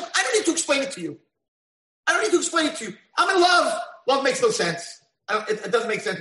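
high, loud, giddy voice of love; lovestruck declaration. Acted. God telling the angels he is in love, giddy and high. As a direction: gush it like someone head over heels.

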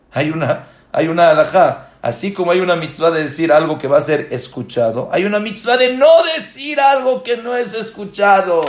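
loud, high sermon shout; preaching at full force. Acted. Rabbi teaching halacha to listeners, loud preaching. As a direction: hammer the law home from the pulpit.

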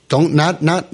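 ordinary talk, nothing marked

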